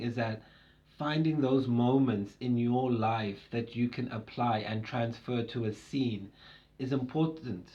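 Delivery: normal talking voice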